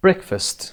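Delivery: "breakfast" starting high on BREAK, then dropping; stress on first syllable. Shown as a demonstration. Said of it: "قسمت اول روی break هست".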